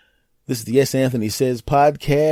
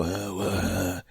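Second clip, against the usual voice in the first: low, soft, sleepy groan into the phone; groggy just-woke grunt; 9 semitones lower, 11 dB quieter than usual. Retold or imitated. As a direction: mumble like you were just woken by the ring. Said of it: "and I go".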